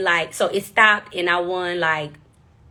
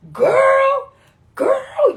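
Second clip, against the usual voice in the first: excited host voice; 3 dB louder than usual. Retold or imitated. Host raving about her singing.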